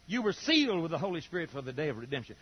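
usual speaking voice

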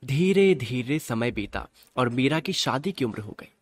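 ordinary talk, nothing marked

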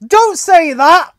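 high, loud, drawn-out protest; scandalized scolding. Real, not acted. Scolding a co-host for joking about tourists dying, scandalized. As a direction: cut them off in loud, drawn-out dismay.

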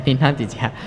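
normal talking voice

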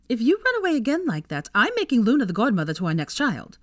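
high, loud mock threat; scolding threat. A narrator's reading. Friend's playful threat at reunion.

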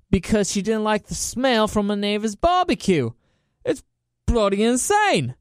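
playful put-on Australian accent. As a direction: tell it like a hammy Australian. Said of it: "I like the Australian accent".

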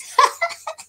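high-pitched burst of laughter. Real, not acted. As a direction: laugh out at your own words. Laughs after mock sad farewell.

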